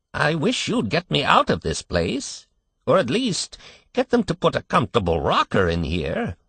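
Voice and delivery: put-on querulous old man. A narrator's reading. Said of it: "went on the old man querulously".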